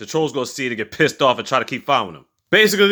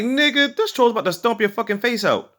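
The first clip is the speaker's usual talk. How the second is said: much higher, incredulous outcry; exasperated disbelief; 10 semitones higher than usual. Retold or imitated. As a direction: shriek at her for being so naive. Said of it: "I'm like".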